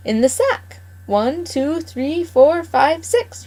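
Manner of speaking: put-on old gardener counting his catch with glee; gloating farmer counting. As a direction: savour each number like a greedy old farmer. Mr McGregor insists on his count.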